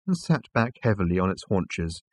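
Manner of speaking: ordinary delivery, nothing marked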